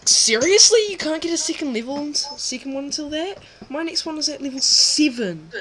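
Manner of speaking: loud, high incredulous outburst; shocked disbelief. Real, not acted. Disbelief at friend's level.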